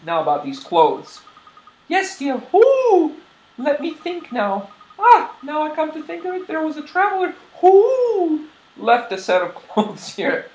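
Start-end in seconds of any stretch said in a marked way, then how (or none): 1.9-8.5 put-on high-pitched old owl shopkeeper; dotty granny owl voice; hooting owl lady. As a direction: speak high and let "who" burst into a hoot.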